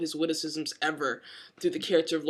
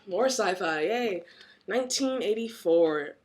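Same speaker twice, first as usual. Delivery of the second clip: usual speaking voice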